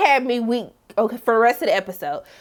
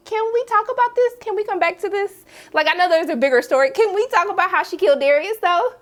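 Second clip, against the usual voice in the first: higher, exasperated; pleading for answers; 6 semitones higher than usual. Retold or imitated. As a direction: beg the show to explain. Demanding more backstory.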